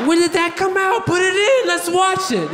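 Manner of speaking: high-pitched, excited mock enthusiasm; comically thrilled, pitch jumping up; giddy eagerness.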